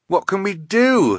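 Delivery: high, urgent plea for help; alarmed question. A narrator's reading. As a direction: ask desperately in an emergency. Klaus asks on runaway sled.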